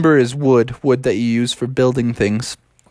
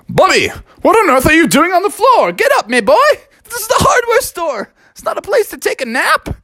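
dad bear scolding his son, much higher; big bear dad. Acted. The dad bear tells off Bubby on the floor, scolding.

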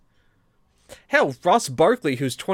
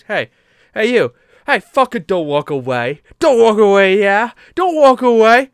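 loud, higher mock English yell; angry drunk shout; 6 semitones higher, 10 dB louder than usual. The drunk hooligan chases the boys, shouting angrily in an English accent. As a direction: bellow after them not to leave. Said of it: "He just goes".

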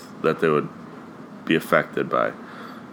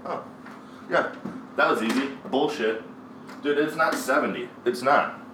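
off-mic, distant and softer; faint, higher-pitched protest from outside; calling out from outside; 5 semitones higher, 9 dB quieter than usual. Real, not acted. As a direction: step away from the mic and call bullshit. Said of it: "Let me walk outside and call bullshit".

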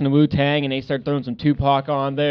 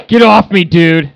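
loud, higher get off me, half-joking; annoyed shout; 4 semitones higher, 13 dB louder, slower than usual. Retold or imitated. Retelling Christofferson waking up pinned, loud annoyed yell. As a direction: shove him off with your voice. Buddy shoved off in bed.

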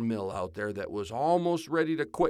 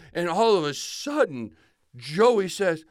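ordinary delivery

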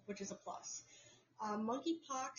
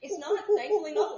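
excited; 8 semitones higher, 15 dB louder than usual. Real, not acted. Relieved it's not monkeypox.

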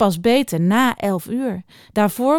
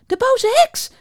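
high alarmed cry; shocked exclamation, pitched way up; startled; 4 dB louder than usual. A narrator's reading. Hare and blackbird spot the witch, startled. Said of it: "zeiden de haas en de merel geschrokken".

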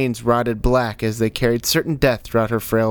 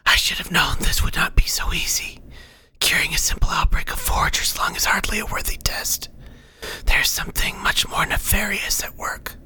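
whispered, breathy orders; whispering instructor; 7 semitones higher than usual. Acted. Unseen guide telling the cleric how to make cures, whispering. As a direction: give instructions in an airy whisper.

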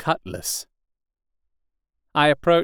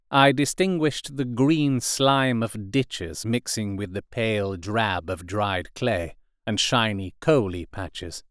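ordinary delivery, nothing marked

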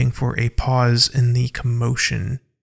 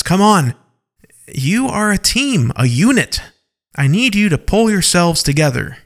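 raised, higher voice with punched words; rally a squabbling team; firm, emphatic appeal; urgent exhortation; 6 semitones higher, 4 dB louder than usual. A narrator's reading. Mork exhorts his bickering team.

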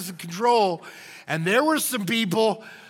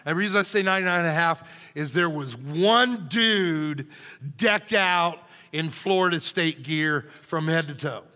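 ordinary delivery

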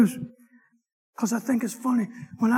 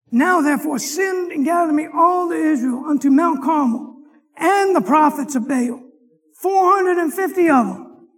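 preaching scripture; 3 semitones higher, 4 dB louder than usual. Acted. Preacher reading Kings to his class, booming=14. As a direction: proclaim it from the pulpit.

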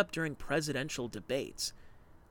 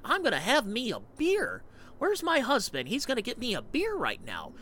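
higher, mocking Warren impression; mock Elizabeth Warren; 9 semitones higher than usual. Podcaster mocking Elizabeth Warren, higher mock voice. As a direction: lampoon her as a folksy beer drinker, pitched up. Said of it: "when I hear Elizabeth Warren".

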